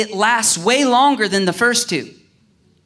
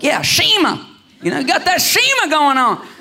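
"Shema" as SHEH-muh, higher and louder, hammed up; mock enthusiasm; 9 semitones higher, 4 dB louder than usual. Preacher showing how he brushed off the correction, mock-excited. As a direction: play up the new word with fake excitement. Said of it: "But I was like".

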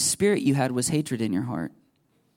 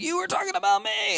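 accusing churchgoer. Retold or imitated. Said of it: "somebody's like".

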